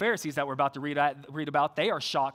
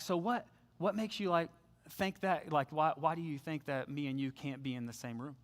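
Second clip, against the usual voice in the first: gentle question; 3 semitones lower, 7 dB quieter than usual. Retold or imitated. Gently asking a hard question.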